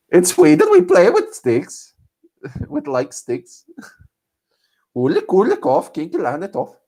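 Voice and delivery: high-pitched, hammed-up Swedish accent. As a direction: put on a sing-song comic Swedish voice. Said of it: "how was my Swedish accent".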